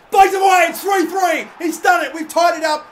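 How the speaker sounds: equaliser celebration shout. Real, not acted. Player celebrating the late equaliser, triumphant shouting. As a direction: roar it as the ball hits the net.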